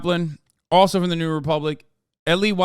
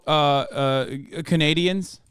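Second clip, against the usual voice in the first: ordinary talk, nothing marked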